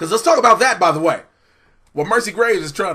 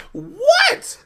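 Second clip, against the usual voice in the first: high, loud, incredulous "What?"; mock astonishment; 9 dB louder than usual. Co-host capping his own joke, loud high astonished yelp. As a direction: yelp it in comic disbelief.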